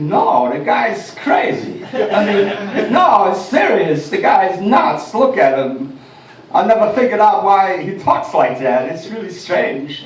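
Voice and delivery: slow, halting, odd rhythm; deadpan slow movie-villain voice; Christopher Walken impression. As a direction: drag it out with strange pauses. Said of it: "do you do Christopher Walken".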